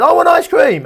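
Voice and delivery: put-on very high, loud toddler wail; toddler tantrum. Toddler refuses to accept no, wailing demand. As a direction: escalate to a full mock tantrum. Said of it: "the toddler says".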